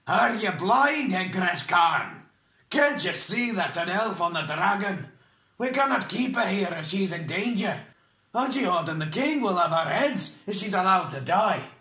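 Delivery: a dwarf rebuking, furious; indignant dwarf. Acted. Dwarf confronts the bald man.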